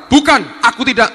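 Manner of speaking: loud, high acted protest; acted outburst of denial; emphatic denial. Peter's second denial acted.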